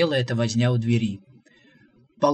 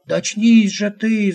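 much higher, louder, slow desperate cry; shout; 7 semitones higher, 8 dB louder than usual. A narrator's reading. Visitor shouting at the stubborn prophet, desperate. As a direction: shake him awake with your voice. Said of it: "закричал Шамбамбукли".

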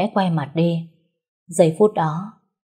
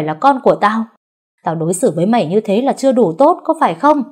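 furious berating; 4 dB louder than usual. Acted. Husband shouting at his pregnant wife, furious. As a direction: hammer her with each reproach.

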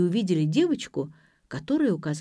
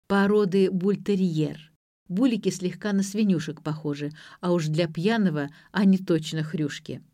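ordinary delivery

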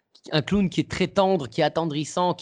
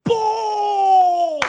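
very high, loud mock shout; shouted bravo; 6 dB louder than usual. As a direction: call out from the back of the hall. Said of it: "un mec qui fait dans la salle".

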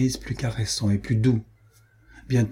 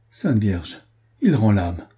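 ordinary delivery

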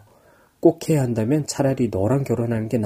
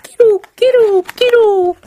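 high, loud, slow gull squawks; seagull cry; 7 dB louder, slower than usual. Shown as a demonstration. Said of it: "갈매기 소리는?".

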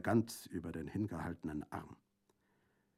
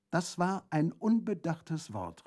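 exclaimed; 9 semitones higher, 4 dB louder, slower than usual. Acted. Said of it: "rief Brandolaccio".

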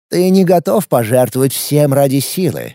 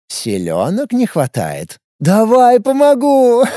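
mocking villain; 8 semitones higher, slower than usual. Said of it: "Вдруг рассмеялся Морн".

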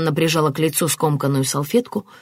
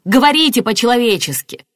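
irritated demand; 7 semitones higher, 5 dB louder than usual. A narrator's reading. Martin scolds the detective.

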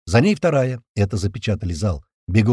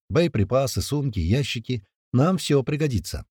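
usual speaking voice

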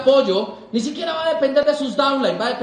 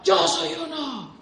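mock crowd shout; 3 semitones higher, slower than usual. Imitating a shouting convention crowd.

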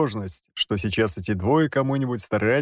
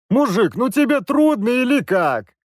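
much higher, louder, fed-up dad; exasperated father; 12 semitones higher, 4 dB louder than usual. A narrator's reading. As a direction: push him, at the end of your rope. Father losing patience.

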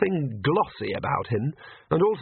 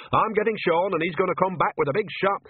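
high-pitched, irate ranting; angry threat; 7 semitones higher than usual. Acted. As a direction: threaten him while storming off. Nanny storms off, threatening help.

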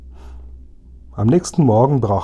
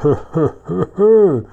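higher "hö hö hö hö" chuckle, the last hö high and loud; gloating robber laugh; 7 semitones higher, 4 dB louder than usual. Acted. Räuber lacht triumphierend. (hämisches Räuberlachen, hoch und laut)

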